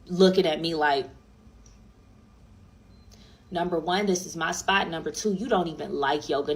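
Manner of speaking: ordinary talk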